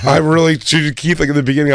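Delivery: usual speaking voice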